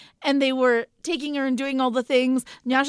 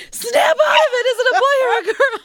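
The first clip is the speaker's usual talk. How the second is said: very high, loud shout through laughter, fast; laughing shout; 9 dB louder than usual. Retold or imitated. Mother yelling at her stunned husband through laughter. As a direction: yell at him while cracking up.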